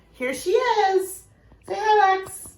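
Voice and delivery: high, slow sing-song to a pet; coaxing the dog. Real, not acted. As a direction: coax the puppy over sweetly. Teacher coaxing her dog.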